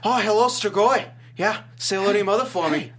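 chatty celebrity small talk, playfully imitated; Mark Wahlberg. Movie star greeting monsters at the airport, mock impression. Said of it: "Mark Wahlberg going into the airport".

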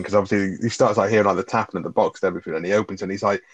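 usual speaking voice

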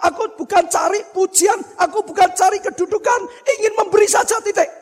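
preaching at full force. Acted. Preacher contrasts getting and giving, preaching fervently. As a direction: hammer the point home from the pulpit.

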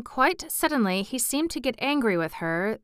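ordinary delivery

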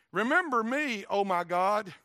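preacher's ringing scripture plea; impassioned plea. Acted. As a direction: call out to heaven in declaimed prayer. Nehemiah's plea to God, declaimed.

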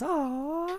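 high, soft cooing "aww"; adoring crowd aww. Acted. Crowd watching kittens get petted, cooing. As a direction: coo it high and long over something cute. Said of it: "Everyone goes".

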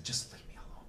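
weak, breathless whisper. Retold or imitated. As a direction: imitate a hurt man's faint whispered plea. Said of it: "I was whispering literally like that".

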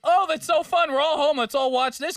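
high, loud, gushing excitement; mock cheerful glee; excited. Acted excited quote about quarantine.

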